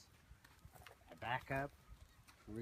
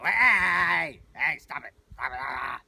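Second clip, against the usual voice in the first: loud mock-alarmed shouting; panicked yelling; 3 semitones higher, 15 dB louder, slower than usual. Owner reacting to fighting horses, panicked shout. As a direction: yell like an overreacting bystander. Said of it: "first reaction a lot of people have".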